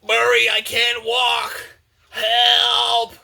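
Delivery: high, slow, drawn-out yell; mock desperate shout, stretched words; hammed-up cry for help. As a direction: wail to a buddy for rescue, playfully. Trapped character cries for help.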